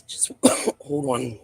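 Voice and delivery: coughing, throat caught; strained voice after swallowing wrong; choking on water. Real, not acted. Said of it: "Choking on water".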